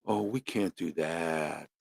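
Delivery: low, slow and soft, mocking a cautious host; mock timid host. Shown as a demonstration. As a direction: play it meek and tiny, slowly. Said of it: "I'm gonna talk like this".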